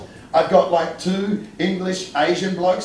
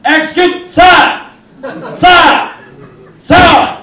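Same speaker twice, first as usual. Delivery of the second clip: loud, high-pitched heckler's holler; Edwardian heckler; 10 semitones higher, 13 dB louder, slower than usual. Retold or imitated. Comic heckling the wrestler walking to the ring, loud Edwardian heckler. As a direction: call out sharply as an indignant period gentleman. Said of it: "I did the Edwardian heckler at the wrestling".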